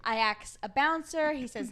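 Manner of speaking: ordinary talk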